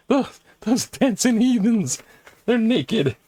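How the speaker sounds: high, scandalised mock missionary voice; prim missionary. Mocking the missionaries who hated naked hula, in a high scandalised voice. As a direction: clutch your pearls at the heathens.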